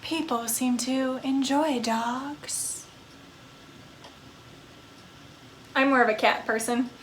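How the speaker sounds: slow, soft, mournful vampire voice; a lonely undead woman drifting through her sorrows; brooding vampire ghost. Acted. Vampire ghost confiding in a lonely spirit, slow and hushed.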